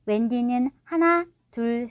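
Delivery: usual speaking voice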